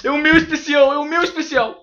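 high, insistent cry; pleading protest. Real, not acted. Fending off friend taking his turn.

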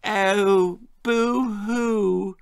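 high mock boo-hoo; mock his mother's crying; sarcastic fake sob; sniggering mockery. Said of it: "sniggered Bill".

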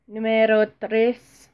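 slow mock Spanish "Numero tres" with a rise at the end; a second hammy Spanish take of "Numero tres"; bad Spanish accent. Shown as a demonstration. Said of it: "that's terrible accent".

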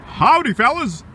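ordinary talk, nothing marked

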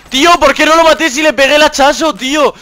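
high, loud, furious complaint; outraged. Real, not acted. Gamer at the game, furious high protest.

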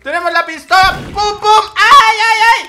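high, loud shrieks in the fight; shrill battle panic; frantic yelling. Real, not acted. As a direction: scream through the shootout. Shootout with the killer.

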